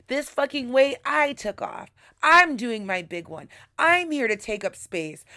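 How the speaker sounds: triumphant, rising self-cheer; hype-up self-talk. Retold or imitated. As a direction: hype yourself up like a fighter walking out. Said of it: "and I was like".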